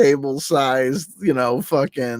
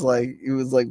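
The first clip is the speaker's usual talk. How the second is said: ordinary talk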